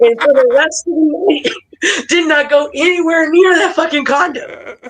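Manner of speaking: high-pitched, laughing punchline delivery; cracking up while telling the story; amused storytelling. Real, not acted. Laughing at his own story's punchline.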